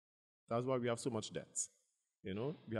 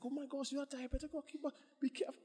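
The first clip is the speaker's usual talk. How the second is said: mock fretting onlooker; 14 semitones higher than usual. People fretting retold.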